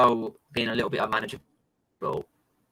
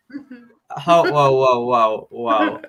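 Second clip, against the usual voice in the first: mock alarm; 2 semitones lower, 4 dB louder, much slower than usual. Mock protest of being overtaken.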